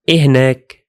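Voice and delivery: إهناك repeated as "ih-nak"; Arabic demo word. Shown as a demonstration. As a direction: model إهناك again as "ih-nak". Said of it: "like this".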